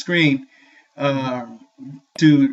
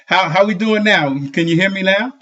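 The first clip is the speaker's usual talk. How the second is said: usual speaking voice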